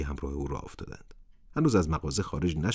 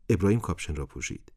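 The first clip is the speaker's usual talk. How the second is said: ordinary delivery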